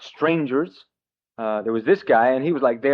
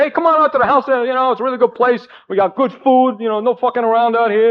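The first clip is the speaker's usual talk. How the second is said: put-on higher-pitched New York wise-guy accent; New York union guy inviting him over, higher New York accent breaking into a laugh; 7 semitones higher than usual. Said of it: "he talked like this".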